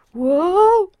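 loud, high rising yelp; startled cry. Acted. Colt tackled from behind, startled. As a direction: shout it out as if knocked off balance.

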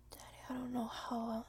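ordinary talk

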